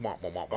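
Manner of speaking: low, soft muted wah wah; Charlie Brown's teacher. Retold or imitated. Comparing the mumbling to a cartoon teacher, muted wah wah. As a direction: do the muffled trombone teacher voice softly. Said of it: "Almost like Charlie Brown's teacher".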